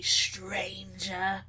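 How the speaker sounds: a menacing whisper on the last word. Acted. King hissing a threat at the stranger, whispered. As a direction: breathe it out like a threat.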